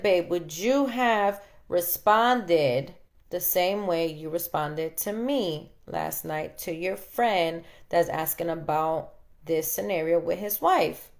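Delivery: slow, each word laid out for him; slow pointed question. Retold or imitated. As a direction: ask it slowly so he has to think. Retelling her question to husband.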